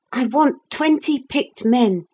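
put-on high-pitched young commander; boy giving orders. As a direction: order the men in a high boy's voice. Philip giving orders to captain.

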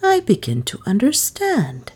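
usual speaking voice

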